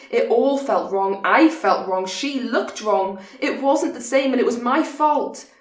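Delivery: loud, building distress with pitch climbing; anguished self-blame. Acted. Distressed confession of guilt in monologue.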